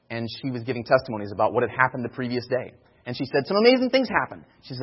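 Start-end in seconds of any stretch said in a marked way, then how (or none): 3.5-4.4 high, loud burst of excitement; retelling a thrilled young woman; excited quote. Retold or imitated. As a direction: gush it out like big news.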